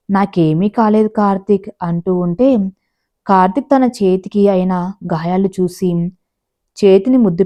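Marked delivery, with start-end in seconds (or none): none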